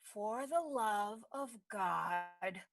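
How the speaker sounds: very slow, low and hushed; restrained exasperation. Acted. Woman fed up with critics, quietly exasperated. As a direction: drag it out softly, holding back before the outburst.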